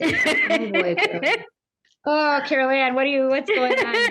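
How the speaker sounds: teasing imitation through a laugh; laughing mock concern. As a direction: humor someone who's losing it, chuckling. Mimics family doubting her sanity.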